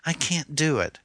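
high, despairing woman's refusal; woman refuses the captor, despairing. Acted. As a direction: throw it out in desperation. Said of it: "threw her hands up".

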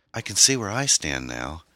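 plain reading voice

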